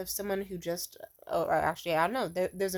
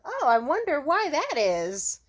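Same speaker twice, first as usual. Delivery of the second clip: sarcastic retort; 6 semitones higher, 6 dB louder, slower than usual. Retold or imitated. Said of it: "something along the lines of".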